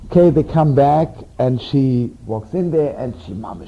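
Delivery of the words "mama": ordinary delivery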